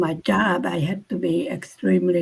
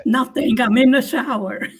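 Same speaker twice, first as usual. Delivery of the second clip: higher, louder teasing answer; teasing reply; 4 semitones higher, 5 dB louder than usual. Pretend. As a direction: toss it off playfully. Mocking her reply.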